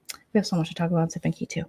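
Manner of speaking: quick whispered aside; fast whisper. Real, not acted. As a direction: rush the whisper to the fans. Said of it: "fast whisper aside".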